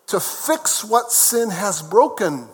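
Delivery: usual speaking voice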